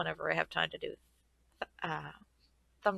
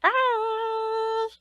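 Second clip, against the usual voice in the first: sing-song high goodbye; 14 semitones higher, 6 dB louder, much slower than usual. Real, not acted. Cutesy farewell to viewers.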